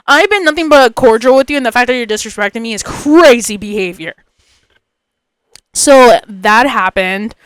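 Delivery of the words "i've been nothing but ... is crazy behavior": ordinary delivery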